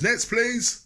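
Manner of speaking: high, loud shop call across the room; shouting clerk. Retold or imitated. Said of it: "it's like he was shouting".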